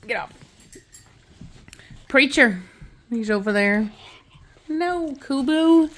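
ordinary talk

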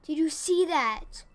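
loud, slow, high outrage; outraged disbelief. Real, not acted. Player to viewers about the near miss, loud and disbelieving. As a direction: demand they saw it, stretched out.

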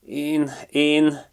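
high, broken stammer; stutter, unable to finish the words; the magpie stammering his innocence. A narrator's reading. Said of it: "dadogta Pali".

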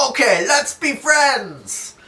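slow, high, loud happy shout; cheerful shout. Acted. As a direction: shout it out like new best friends. Acted reconciliation.

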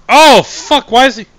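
high, loud frightened yelp; jump-scared shriek; startled scream. Real, not acted. Startled by enemy in game.

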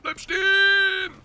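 very high, drawn-out yell; panicked shout. Real, not acted. Gamer yelling at the game to stop, panicked shout. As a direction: scream it out, stretching the last word.

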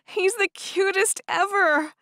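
high, loud squeal of delight. A narrator's reading. Young woman seeing her new puppy, squealing with delight. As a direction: squeal it, far up in pitch. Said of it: "She let out a squeal".

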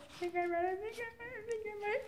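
high, soft and tense; breathless dawning realization; hushed excitement. Real, not acted. Guessing the plot twist.

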